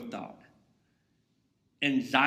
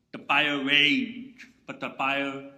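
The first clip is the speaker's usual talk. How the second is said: dramatic; 5 dB louder, much slower than usual. Acted. Dramatizing the fire.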